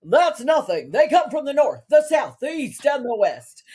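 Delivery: he answers the brush-off, mock-evangelist voice; evangelist flourish. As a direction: proclaim it like a revival sermon. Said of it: "To which he replied".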